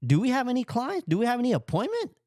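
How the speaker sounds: high, urgent, rising questions; retold panic. Reliving his alarm at the coworker, panicky and high. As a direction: panicky pitch jump on realizing the deadline. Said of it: "I'm like".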